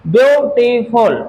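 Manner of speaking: ब्यूटीफुल slowly split, ब्यू-टी-फुल; syllable by syllable. Shown as a demonstration. Teacher demonstrates syllables to the class, ब्यूटीफुल broken into three parts. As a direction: sound out ब्यूटीफुल in three slow, separate beats. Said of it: "ये होता है".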